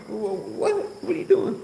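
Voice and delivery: high-pitched startled yelp; mock startled. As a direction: squeak it like a shocked kid. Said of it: "and I'm like".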